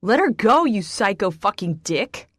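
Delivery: screaming insult; furious yell. Acted. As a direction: shout him down. Jack yelling at Raynor.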